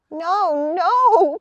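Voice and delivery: high, loud desperate no; pleading. Acted. Woody and Pip pleading with the gnomes, high and loud. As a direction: plead for your lives. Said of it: "pleaded Woody and little Pip".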